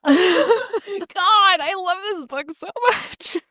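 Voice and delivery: high, laughing delight; bubbly high-pitched fangirl joy; giddy gushing. Real, not acted. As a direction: gush about a favourite book. Gushing about the book.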